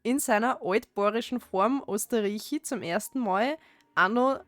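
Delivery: broad Austrian dialect read-aloud. Shown as a demonstration. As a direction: read it just as the dialect is spoken. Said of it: "as you would speak it in the dialect".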